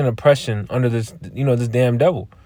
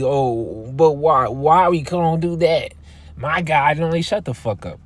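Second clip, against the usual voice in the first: higher, whining voice of the brainwashed; mocking whiny complaint; 4 semitones higher than usual. Preacher mocks oppressed people defending oppressors, whiny. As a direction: mimic them protesting, incredulous.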